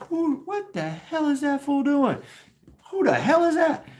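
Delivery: high-pitched mock disbelief; astonished onlookers. Onlookers gawk at the outfit, high mock shock. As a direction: squeal it like a scandalized bystander.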